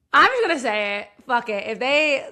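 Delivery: ordinary delivery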